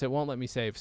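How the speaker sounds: ordinary talk, nothing marked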